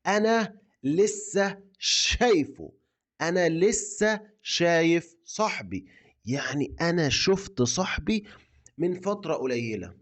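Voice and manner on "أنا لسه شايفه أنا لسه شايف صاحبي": slowed-down repeat of the answer; slow model reply. Shown as a demonstration. Teacher slowly repeats the friend's answer for learners. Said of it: "ممكن تقول له".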